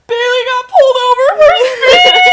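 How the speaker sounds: high, loud, sobbing blurt; crying tattle shout. Retold or imitated. Little brother sobbing out the news to dad, loud crying shout. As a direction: wail it out to dad like a snitch.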